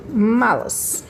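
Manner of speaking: malas drawn out slowly, MA stressed; stress on the first syllable. Shown as a demonstration. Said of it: "a minha sílaba mais forte".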